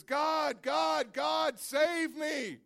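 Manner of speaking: high, loud shouting, slowing on save me; frantic shout to God. Acted. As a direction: yell up to heaven in panic. Man cries out as water rises.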